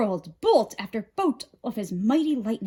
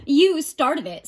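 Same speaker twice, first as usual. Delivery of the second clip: a sharp, louder snap; snapping; 6 semitones higher, 7 dB louder than usual. A narrator's reading. Demeter retorts to Hestia, snapping sharply. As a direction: bite the words off. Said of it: "snapped Demeter".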